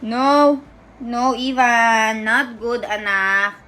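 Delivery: drawn-out, sing-song no-no; mock-scold with long stretched vowels. Real, not acted. Gently scolding baby and daddy. (malambing na pagsaway, pahaba)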